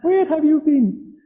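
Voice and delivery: very high, slow mock-royal English accent; drawn-out falsetto take on a royal English accent; Queen Elizabeth II. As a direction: put on a loud, high-pitched regal English accent. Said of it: "That's my Queen Elizabeth the second act".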